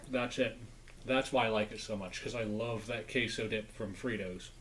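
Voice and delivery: chewing while talking; speaking around a bite; mouth full. Real, not acted. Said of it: "talking with my mouth full".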